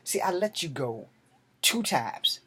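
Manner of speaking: ordinary talk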